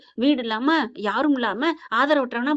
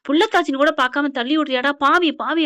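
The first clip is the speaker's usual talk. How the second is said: louder, pitched-up grandmother's rebuke; retold outraged scolding; 3 semitones higher, 5 dB louder than usual. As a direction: curse him in mock outrage. Grandmother scolds after a shove. (ஆத்திரமாக திட்டுவது போல)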